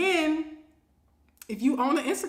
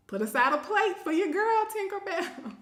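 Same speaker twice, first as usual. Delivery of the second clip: high, teasing plea ending in a laugh; cheekily beg, pitch lifted, giggle at the end; playful plea; 9 semitones higher than usual. Real, not acted. Host asking a grilling viewer for food, playful plea.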